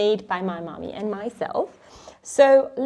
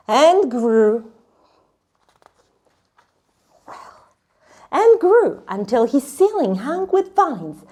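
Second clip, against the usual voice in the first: lively picture-book read-aloud, hushed on the forest; reading to children; 2 semitones lower, 5 dB louder than usual. Acted. Teacher reading Where the Wild Things Are aloud to kids. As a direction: read with wonder, dropping to a whisper for the magic.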